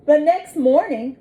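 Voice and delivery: usual speaking voice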